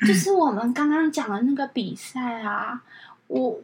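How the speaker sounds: slow, quiet dithering caller; slow caller mimic. Assistant imitates caller finally asking, slow and vague. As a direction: drag it out, mumbling.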